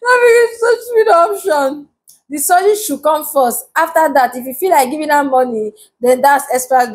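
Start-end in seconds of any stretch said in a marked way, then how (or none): 0.0-1.9 high, slow wail through laughter; laughing disbelief. Real, not acted. Vlogger mocking a stupid option from a comment, laughing. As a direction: repeat it in a drawn-out, high laughing whine.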